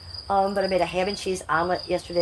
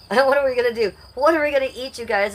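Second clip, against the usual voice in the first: exasperated outcry; 6 semitones higher, 6 dB louder than usual. Real, not acted. Exasperated about what to eat.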